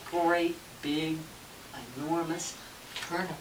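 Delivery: low, soft, spaced-out words; hushed story ending. A narrator's reading. Storyteller closes the tale for children, hushed and low. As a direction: let each word settle softly with a pause.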